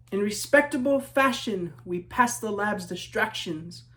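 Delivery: lilting rhyming read-aloud; story narration. A narrator's reading. Author reading to children, storybook narration. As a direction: read it like a picture book to kids.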